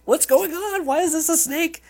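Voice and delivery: very high, squeaky panic; panicked Moses. Pretend. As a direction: shriek it like he's terrified of the snake. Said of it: "I'd love to imagine he was".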